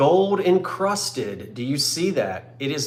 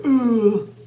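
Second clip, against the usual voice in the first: high, louder groaning ooh as if getting out of a chair; 7 semitones higher, 5 dB louder than usual. Shown as a demonstration. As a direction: let out an old man's creaky ooh. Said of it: "show sound effects people".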